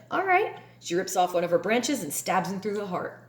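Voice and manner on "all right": high, breezy all right; offhand all right. Retold or imitated. Daughter tree answering the greedy boy, breezy. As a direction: toss it off lightly before the stab. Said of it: "she's like".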